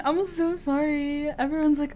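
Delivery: ordinary talk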